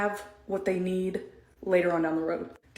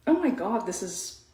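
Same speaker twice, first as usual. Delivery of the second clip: ordinary talk, nothing marked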